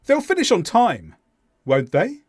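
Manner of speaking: "won't they" rising on "they"; stress on THEY. Shown as a demonstration. Teacher tests learners with an unsure tag, "they" stressed, rising. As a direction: genuine question, lifting "they" at the end. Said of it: "the stress is on the subject pronoun".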